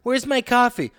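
high demanding customer voice; impatient customer. Acted. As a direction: demand your coffee impatiently. Acted barista scene.

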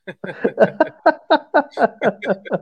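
high, loud burst of laughing; loud laughter. Real, not acted. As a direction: crack up at yourself. Cracks up after repeating link.

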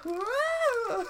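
very high, loud falling yelp; Wilhelm scream. Retold or imitated. Copying the actor rolling off the bed, Wilhelm scream. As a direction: let out the classic stock movie scream. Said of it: "He Wilhelms right off the bed".

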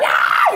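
very high, loud mock shriek; a sudden shrill scream; retold shout. The double storms in screaming, very high shriek. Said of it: "Gritó".

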